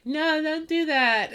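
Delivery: slow, high, drowsy no; sleepy protest. Retold or imitated. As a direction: protest like you're half asleep. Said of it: "she just sounds, like, sleepy".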